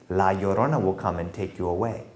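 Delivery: plain reading voice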